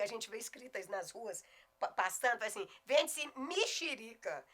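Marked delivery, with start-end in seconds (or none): 2.8-4.4 MEXERICA said "mi-shi-RI-ca", slow and higher; regional pronunciation. Acted. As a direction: read out a street sign, MEXERICA with I sounds, "mishirica". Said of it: "Mexerica é um som regional".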